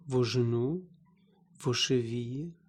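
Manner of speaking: ordinary delivery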